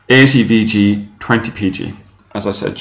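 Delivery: ordinary delivery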